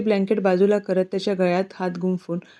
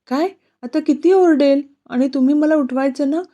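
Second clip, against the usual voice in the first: higher, louder exasperated fretting; irritated scolding; 6 semitones higher, 4 dB louder than usual. A narrator's reading. Woman scolding him for not waking her, irritated. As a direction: scold him in a raised, high voice. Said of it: "ती त्याचा हाताला मारतच चिडून बोलते".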